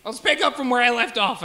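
ordinary delivery

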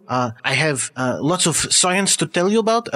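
put-on halting, accented man of science; eccentric doctor. Doctor thanks the host for having him, in a pleased accented voice. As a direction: play a pleased foreign scientist, searching for words.

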